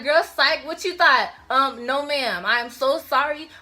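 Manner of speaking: mock the idea with attitude; sassy refusal. Girl refusing to repeat fifth grade, sassy and teasing. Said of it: "And I was like".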